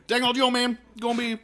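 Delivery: loud, high, rapid-fire mumble; Boomhauer. Acted. Co-host showing how the cartoon character talks, Boomhauer mumble. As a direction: run the words together, high and loud. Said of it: "boomhauer, like, like".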